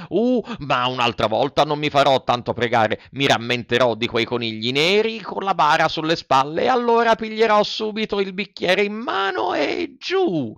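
put-on childish promise rising to a high finish; eager Pinocchio. Puppet promises the fairy he will drink, eagerly.

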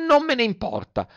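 very high bratty retort; defiant Pinocchio. Acted. Puppet brushes off the fairy's warning, defiant. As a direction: answer back in a high childish voice.

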